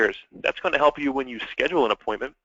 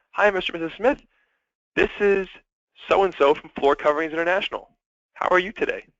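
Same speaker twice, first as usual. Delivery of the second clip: higher pitch, accelerated and friendly; enthusiastic phone greeting; 6 semitones higher than usual. Shown as a demonstration. Sales rep opening an outbound call, pitch up and enthusiastic. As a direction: sound like the friendliest person you know. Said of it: "the pitch is going up".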